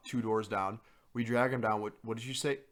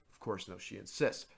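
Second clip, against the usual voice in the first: normal talking voice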